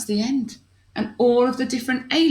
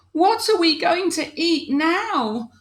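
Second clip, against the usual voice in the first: worried alien; 11 semitones higher than usual. Acted. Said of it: "asked one of the worried aliens".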